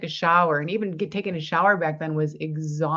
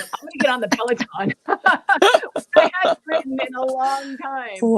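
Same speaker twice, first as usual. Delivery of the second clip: laughing through the words; giggling, voice breaking up with laughter; 6 semitones higher, 4 dB louder than usual. Real, not acted. Excited Peloton story with laugh.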